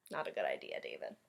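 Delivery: low, soft warning. Acted. Reader warning David about cutting, low and soft. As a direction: drop low and warn him quietly.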